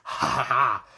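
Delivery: loud mock hearty laugh; reckless laugh. Hunter laughs after missing the dragon, loud laugh. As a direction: laugh off the miss like a madman. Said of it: "laughs like this".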